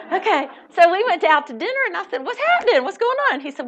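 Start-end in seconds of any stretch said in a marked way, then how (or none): 2.2-3.3 high, eager asking; mock-eager curiosity; eager questions. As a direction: push for the news.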